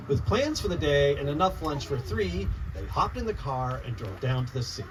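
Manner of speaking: lively rhyming storybook read-aloud; reading to children. Acted. Author reading her picture book to children, lively read-aloud. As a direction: bounce the rhymes for a room of kids.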